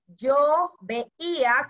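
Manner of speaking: each syllable drawn out very slowly; slow dictation. Shown as a demonstration. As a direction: dictate it slowly so they can copy. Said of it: "con tilde en la i". (dictando muy despacio)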